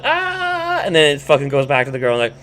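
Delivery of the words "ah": high, sustained "aah"; one long high-pitched mock scream; imitated Helper scream. Helper cutting into the girl's call, high scream. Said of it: "it shows it's Helper going".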